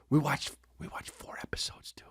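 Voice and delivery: gleeful whispered brag. Real, not acted. Bragging to listeners about his win, whispered. Triumphant secret aside.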